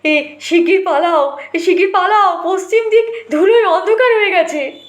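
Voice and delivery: panicked villager yelling that the raiders come; urgent warning shout. Acted.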